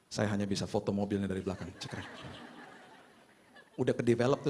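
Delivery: ordinary delivery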